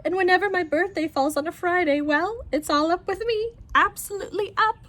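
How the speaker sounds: high, rising uncle voice; giggly old uncle. Acted. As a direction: lift it up like a floating old man. Uncle Albert voiced by narrator.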